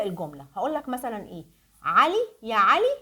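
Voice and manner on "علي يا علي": calling out. Pretend. Teacher calls imaginary student Ali.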